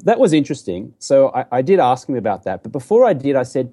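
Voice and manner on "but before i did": ordinary delivery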